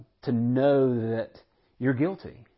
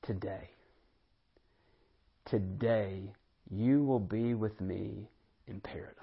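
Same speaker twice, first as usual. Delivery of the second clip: gentle promise; 4 dB quieter than usual. Acted. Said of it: "And what does Jesus say?".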